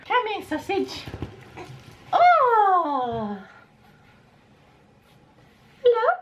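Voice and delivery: very high, bright cooing. Real, not acted. Mother cooing to baby.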